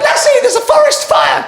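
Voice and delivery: high, loud shout to the dog; urgent shout. Acted. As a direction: yell it like a film hero. Owner shouting to Lassie.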